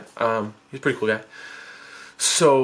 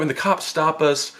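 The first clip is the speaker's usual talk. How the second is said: plain reading voice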